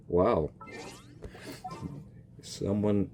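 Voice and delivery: ordinary talk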